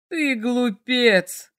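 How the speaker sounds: slow rumbling dragon voice; rumbling roar. A narrator's reading. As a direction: roll it out like a rumbling beast. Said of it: "пророкотал дракон".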